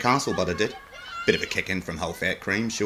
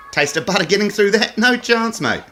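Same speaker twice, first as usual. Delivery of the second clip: high mocking jibe; 12 semitones higher, 6 dB louder than usual. Comedian taunting the Queen, much higher and louder. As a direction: pitch jumps way up on a cheeky jab.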